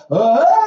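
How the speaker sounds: put-on loud, high wail of a crying kid; imitated child's wail. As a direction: mimic a child bawling after a spanking. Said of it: "they would cry, and they keep going".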